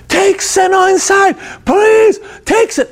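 put-on high, pleading kid's cry; panicked little boy's shriek, imitated; imitating screaming child. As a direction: beg at the top of a child's voice. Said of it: "heard this kid scream like this".

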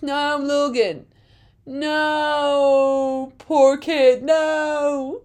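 mock heartbroken. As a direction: grieve for him, lightly. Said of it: "I was like".

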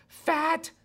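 high, squawked mock dismissal; exaggerated naysayer jeer; sceptical scoff. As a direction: scoff it high, breaking between the words. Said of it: "natural mindset that says".

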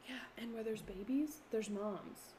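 spooky whisper. Real, not acted. Teasing fear.

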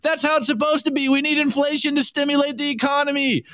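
high, hammed-up sobbing whine; crying Wojak voice. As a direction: whine it like a crybaby defending inflation. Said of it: "And then the crying Wojak".

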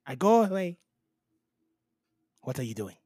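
ordinary delivery

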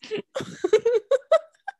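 high embarrassed giggle; nervous giggle. Real, not acted. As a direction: laugh it off nervously. Giggling after nervous admission.

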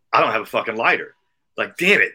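loud retold frustration; exasperated. Finding his lighter gone before a smoke, loudly exasperated.

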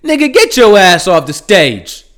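imagined crowd member yelling at the speaker to get off; heckler yelling. Retold or imitated. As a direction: shout him off the stage like a fed-up audience member. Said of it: "hearing people yell".

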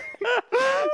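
squealing high laugh; high giggle. Real, not acted. Laughing at his own photo.

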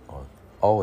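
normal talking voice

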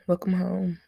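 usual speaking voice

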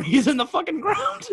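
blurted through laughter; laughing. Real, not acted. Teasing his friend about the dead dog, laughing. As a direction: crack up mid-sentence.

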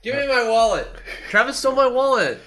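high, loud fake complaint; mock yell. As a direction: shout it for the bit. Guest yelling about stolen wallet.

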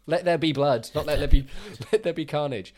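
loud, high demand for blood; real fan outburst, laughing at the end; excited shout. Real, not acted.

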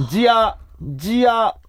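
「ジア」のジとアを分けてゆっくり; 「ジア」を一音ずつ分けて丁寧に; 分けて発音. Shown as a demonstration. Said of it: "ジとアは分離して発音してください". (「ジア」をジとアに分けてゆっくり発音)

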